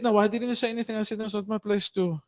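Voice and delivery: normal talking voice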